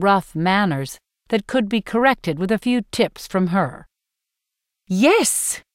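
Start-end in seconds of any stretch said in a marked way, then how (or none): 4.9-5.6 hissing. A narrator's reading. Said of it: "hisses Effie Trinket".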